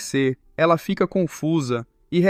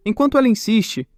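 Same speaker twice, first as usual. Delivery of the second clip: usual speaking voice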